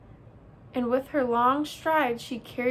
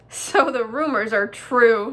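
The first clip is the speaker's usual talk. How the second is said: loud, mocking delivery with little real laughter; 8 dB louder than usual. A narrator's reading. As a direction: jeer as the amused bear. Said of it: "Nettle laughed".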